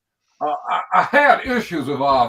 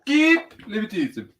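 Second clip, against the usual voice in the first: mock Shannon Sharpe; 8 semitones higher, 4 dB louder than usual. Mocking Shannon and Sharp debate.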